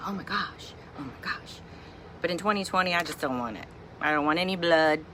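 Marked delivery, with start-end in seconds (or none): 0.1-1.6 breathy whispered oh my gosh; hushed, hooked on the show; whispering while watching with Emily; whispered gasping awe. Retold or imitated.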